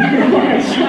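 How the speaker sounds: quick bright burst of laughter; real laugh. Real, not acted. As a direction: laugh at your own joke. After "sounds very exciting" joke.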